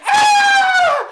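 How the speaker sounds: high piercing scream. Acted. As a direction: shriek in fright. Lady screams at ghost.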